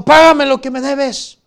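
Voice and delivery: grito alto y agudo, repetido con fuerza; exigencia furiosa. Acted. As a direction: reclama la deuda a gritos, como acreedor implacable. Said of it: "le ahogaba diciendo". (grito furioso dramatizado)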